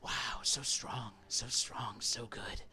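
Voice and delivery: soft unvoiced encouraging whisper. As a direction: voice in his head whispering praise. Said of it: "he's like".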